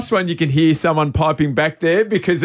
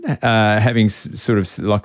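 usual speaking voice

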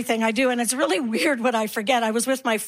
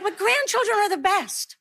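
gushing friends; 6 semitones higher than usual. Acted. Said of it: "And they're like".

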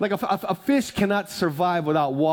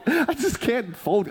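laughing; 6 semitones higher, slower than usual. Real, not acted. Laughs at own hatred of folding.

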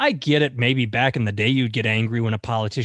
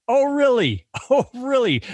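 sarcastic; 11 semitones higher than usual. Real, not acted. Sarcastic retort to Cruz.

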